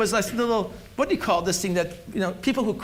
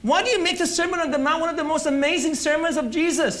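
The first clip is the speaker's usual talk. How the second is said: preaching; 5 semitones higher, much faster than usual. Acted. Preaching rhetorical questions.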